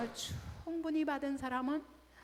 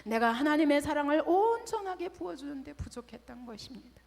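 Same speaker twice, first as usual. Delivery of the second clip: ordinary delivery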